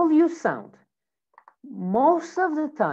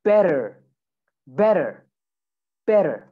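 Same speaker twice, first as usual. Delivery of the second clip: American accent; 5 dB louder than usual. Shown as a demonstration. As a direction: say "better" the American way, like "bedder". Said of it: "American accent, you would say better".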